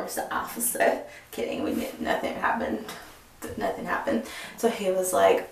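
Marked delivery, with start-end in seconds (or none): 0.0-4.2 whispered aside. Real, not acted. Joking aside about flirting.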